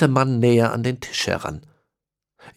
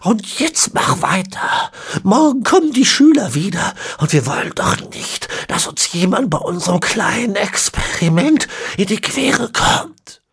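ancient villain; 11 semitones higher, 4 dB louder than usual. A narrator's reading. Ezekiel explaining the horse bones, high and whispery. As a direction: rasp out the words like a centenarian.

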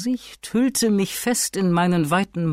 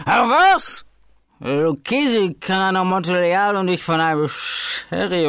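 put-on high, loud drunken scoff; scornful outburst; 4 semitones higher, 3 dB louder than usual. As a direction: laugh off the rival as a fool. Fortunato scoffs at rival Lucchesi. (höhnisch empört über Lucchesi)

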